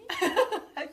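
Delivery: loud, high burst of laughter; real laugh. Real, not acted. Child laughing after reading.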